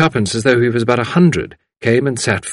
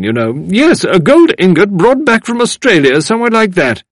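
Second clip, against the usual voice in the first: put-on loud, high, emphatic old woman; know-it-all elder overriding everyone; grim woman insisting; 13 semitones higher, 7 dB louder than usual. A narrator's reading. Woman insists she knows more.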